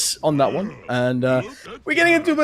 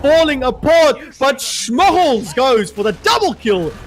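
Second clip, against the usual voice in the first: high, loud hyped call; shout casting; 9 semitones higher, 4 dB louder than usual. Acted. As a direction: build the hype on every beat. Shout casting a big play.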